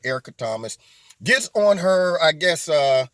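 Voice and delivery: normal talking voice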